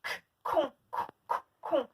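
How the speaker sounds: bare "K", then "con" repeated with a hard k; highlighted k sound. Shown as a demonstration. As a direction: pop the first consonant of "con". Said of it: "Ici, on entend le son k".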